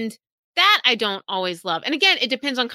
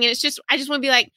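ordinary talk

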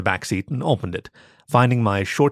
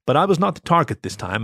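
ordinary delivery, nothing marked

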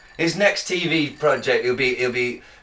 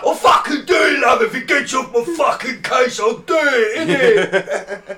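mock chav shout; 8 semitones higher, 5 dB louder, slower than usual. Mocking guest's reply.

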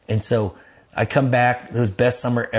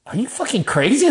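high, incredulous challenge; outraged disbelief; 12 semitones higher, 3 dB louder than usual. Retold or imitated. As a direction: act out everybody's shocked reaction. Church people react outraged to him.

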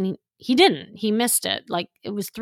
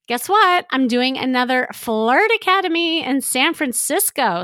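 much higher and louder, bursting with news; excited announcement; 5 semitones higher, 4 dB louder than usual. Real, not acted. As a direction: tease the big news. Announcing her event.

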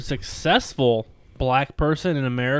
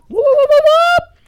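high, loud whoop calls; imitating mating call; 13 dB louder than usual. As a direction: call out like a bird. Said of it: "and I heard".

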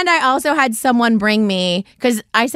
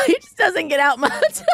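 higher voice breaking into laughter; laughing; 13 semitones higher than usual. Real, not acted. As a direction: crack up while saying it. Cracks up telling her story.

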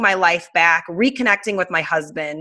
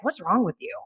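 softer, higher questioning; retold puzzled concern; 5 semitones higher, 6 dB quieter than usual. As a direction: imitate a husband gently puzzled. Husband's first question, retold.

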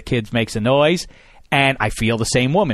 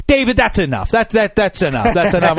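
scolding mother; 5 semitones higher than usual. Retold or imitated. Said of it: "she's like".

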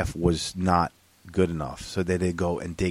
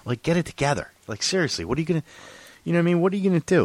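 exasperated; 8 semitones higher, faster than usual. Retold or imitated. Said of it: "I was like".

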